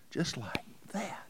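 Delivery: soft whisper lifting at the end; hushed echo, rising tune. Real, not acted. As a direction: whisper it again with a lift. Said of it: "repeated whisper, rising".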